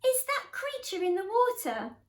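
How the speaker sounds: very high hooted who, easing down; wondering question. A narrator's reading. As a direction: ask in high-pitched wonder. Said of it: "he asked them".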